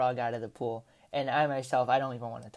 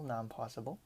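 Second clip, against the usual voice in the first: much softer, trailing-off echo of not impossible; quiet repeat; 3 semitones lower, 12 dB quieter than usual. Real, not acted. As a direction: murmur it again to yourself. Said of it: "repeated phrase, trailing".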